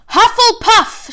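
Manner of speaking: loud, high, drawn-out call of the house; booming Sorting Hat proclamation; hat shouting. A narrator's reading. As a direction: announce it to the whole hall. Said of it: "shouted the hat".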